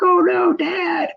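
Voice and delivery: high, crying-out mock alarm; family on the bank watching Dad flip, mock panicked cry; freaking out. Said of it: "well, they're freaking out".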